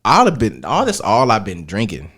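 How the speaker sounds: ordinary delivery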